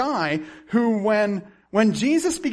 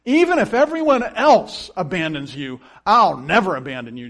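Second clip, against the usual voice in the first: loud, emphatic, boastful declaration; arrogant vow; 7 dB louder than usual. Acted. Peter boasts to Jesus before the cross, arrogant and loud. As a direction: proclaim it with overblown self-assurance. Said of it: "Peter arrogantly says".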